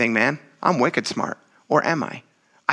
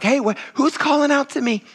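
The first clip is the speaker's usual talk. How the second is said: higher, puzzled lightly voiced; a hint of confused looking around; mock distracted Jesus; 9 semitones higher than usual. Jesus imagined turning around puzzled, mock confusion. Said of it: "Jesus doesn't turn around and say".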